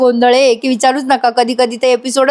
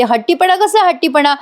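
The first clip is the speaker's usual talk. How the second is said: a higher, mock-angry snap at Mahesh; angry retort; 6 semitones higher than usual. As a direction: throw the word back at him in light acted anger. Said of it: "Avni चिडते आणि म्हणते". (उंच आवाजात नाटकी रागाने उलट प्रश्न)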